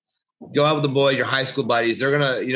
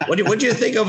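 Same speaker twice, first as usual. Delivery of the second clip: ordinary delivery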